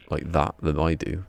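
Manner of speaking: ordinary talk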